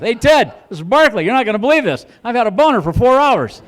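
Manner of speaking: high, loud, thrilled bragging; excited bragging call. Pretend. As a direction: brag to old school friend Ted. Excitedly calling old school friends.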